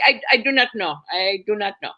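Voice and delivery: put-on deliberate, hesitant Indian-accented reply; put-on Indian English. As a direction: play a baffled man with an Indian accent. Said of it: "the Indian".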